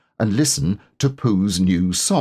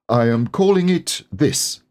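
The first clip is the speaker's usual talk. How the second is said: self-important; 7 semitones higher than usual. Acted. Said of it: "said Owl importantly".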